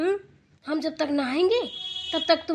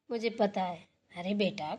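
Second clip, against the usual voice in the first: softer, lower, tender; gentle knowing; 5 semitones lower, 8 dB quieter than usual. Acted. Mother-in-law softly consoling her tearful daughter-in-law, tender. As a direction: ease off and soothe her.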